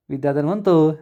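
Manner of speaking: plain reading voice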